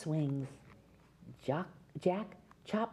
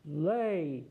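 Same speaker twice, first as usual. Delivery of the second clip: giant yelling; 2 semitones lower than usual. A narrator's reading. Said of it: "yelled the giant".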